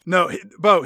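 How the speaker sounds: normal talking voice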